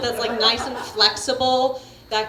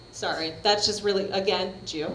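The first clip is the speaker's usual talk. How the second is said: ordinary talk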